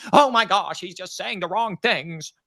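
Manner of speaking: clutch your pearls and gasp it out. Retold or imitated. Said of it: "clutching their pearls and going".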